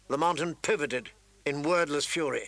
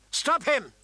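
high-pitched shouted order; urgent shout; 10 semitones higher than usual. A narrator's reading. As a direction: shout to halt an attacker. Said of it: "Ned shouted".

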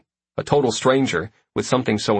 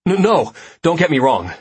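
quickly; 7 semitones higher, 5 dB louder than usual. Acted. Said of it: "I say quickly".

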